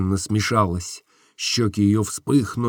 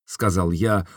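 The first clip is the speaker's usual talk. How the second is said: plain reading voice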